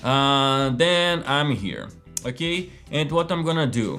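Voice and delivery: usual speaking voice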